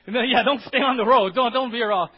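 loud, high playful warning; loud joking shout. Real, not acted. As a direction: call out to the driver as a joke. Shouting at the driver.

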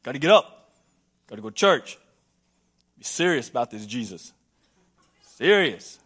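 lightly hammed-up dutiful grumble; a touch of stern duty; mock grim churchgoer. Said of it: "we're serious".

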